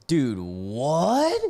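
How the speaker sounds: baffled, with a rising what; incredulous. Real, not acted. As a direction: react in stunned disbelief, voice climbing at the end. Stunned at what was read.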